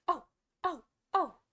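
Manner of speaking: high, loud screamed "oh"s; shouted cries of pain. A narrator's reading. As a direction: yelp sharply in separate bursts. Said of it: "shouted the Queen".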